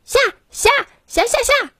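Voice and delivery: high squeaky duck quacks in Chinese; duck quacking. A narrator's reading. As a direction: squawk high like a frantic duck. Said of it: "平大叫起来".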